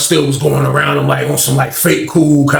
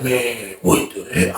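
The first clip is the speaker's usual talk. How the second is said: hushed shock; 6 semitones lower, 8 dB quieter, much slower than usual. Retold or imitated. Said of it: "He was like".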